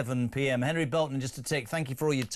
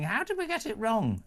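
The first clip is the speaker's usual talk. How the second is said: hammed-up posh whine; mock Tory accent; 7 semitones higher than usual. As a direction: mimic a bewildered Tory, pitched up. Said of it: "Again, another accent".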